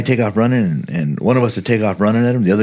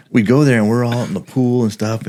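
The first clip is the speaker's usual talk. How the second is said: usual speaking voice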